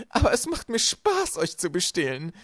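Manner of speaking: high, loud, drawn-out relish; gleeful villainess. A narrator's reading. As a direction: savour it loudly, pitched high. Villainess revels in stealing. (genüsslich gedehnt, hoch und laut)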